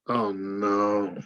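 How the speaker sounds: low, hushed re-enacted dismay; retold stunned, mournful reaction; grieving disbelief. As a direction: sink into it as if hearing of a death. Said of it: "you'd think somebody really died".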